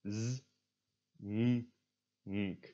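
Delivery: "Z" as a bare buzz, "n" and "nk" hummed through the nose; phonics-style "Z", "n", "nk", not letter names; isolated consonant sounds. Shown as a demonstration. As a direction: sound out "Z, n, nk" one by one. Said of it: "pronounce them".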